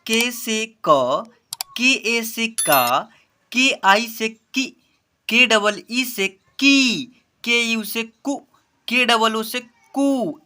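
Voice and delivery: slow chanted spelling, final syllable drawn out; measured classroom chant; spelling drill recitation. Shown as a demonstration. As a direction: recite each spelling slowly, landing on the syllable.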